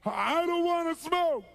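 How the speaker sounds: high, slow, loud whine; imitated panicked crying protest; freaked-out wail. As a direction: drag it out in mock anguish. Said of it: "He's freaking out".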